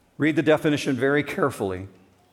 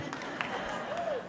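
high squeal of a laugh; real laugh at own joke; burst of laughter; 13 dB quieter than usual. Real, not acted.

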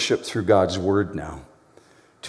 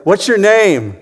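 burglar asking; 9 semitones higher, 7 dB louder, slower than usual. Acted. Burglar questions the parrot.

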